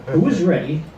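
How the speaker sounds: usual speaking voice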